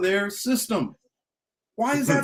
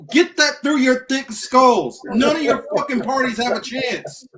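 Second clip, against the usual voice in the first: exasperated scolding; 5 semitones higher, 5 dB louder than usual. Real, not acted. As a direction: hammer each word into their heads. Ranting at listeners.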